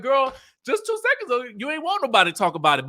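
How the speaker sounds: fast, high-pitched calling-out; retold disbelief. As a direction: rattle off a mock incredulous objection. Retelling own incredulous reply to Letitia.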